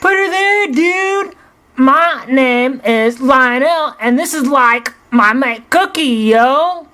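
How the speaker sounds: put-on very high, laid-back Valley dude accent; slow and chill, Valley accent all the way. Lionel introducing himself and mate in Valley accent. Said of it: "in the Los Angeles Valley accent".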